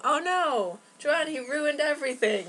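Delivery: hammed-up dismay at the sister; mock outrage.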